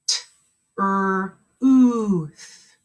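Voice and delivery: "T, er, ooth" said as three separate sounds with pauses; teacher segmenting "T, er, ooth" sound by sound. Shown as a demonstration. Teacher models sounding out a word for kindergartners, segmented. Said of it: "Make sure you're saying them".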